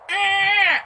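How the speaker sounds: high mocking grunt. Podcast host mocking a movie cover, mock grunt. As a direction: imitate the movie face with a grunt. Acting out Casper Van Dyck face.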